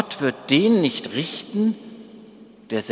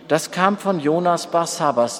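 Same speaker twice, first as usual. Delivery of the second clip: ordinary delivery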